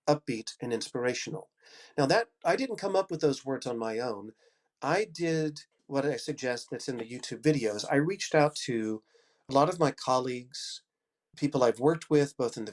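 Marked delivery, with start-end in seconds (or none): none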